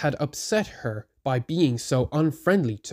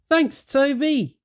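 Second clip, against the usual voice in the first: slow, higher relieved thanks; much higher relieved engine; grateful thanks; 13 semitones higher, 4 dB louder, slower than usual. A narrator's reading. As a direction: thank him warmly. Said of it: "Thomas felt better".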